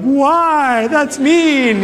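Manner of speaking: high, teary whine; mock a sobbing teen's protest; hammed-up teary son whining to his mom; whiny crying. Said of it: "And I'm all crying and stuff".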